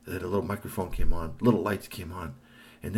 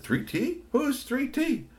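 puzzled robots; slower than usual. A narrator's reading. Robots around voiced.

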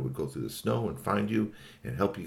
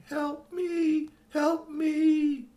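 pleading robot dog; much slower than usual. A narrator's reading.

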